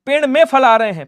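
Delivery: में pushed out clearly and loud; stress on में. Shown as a demonstration. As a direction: lean into में to show the fix. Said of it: "पेड़ से फल नहीं".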